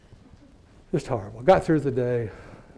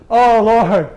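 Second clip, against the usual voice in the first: loud, high, pious pleading; mock martyr begging God to test him; self-righteous prayer; 6 semitones higher, 9 dB louder than usual. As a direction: ham up a holier-than-thou prayer. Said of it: "I went into that self-righteous mode".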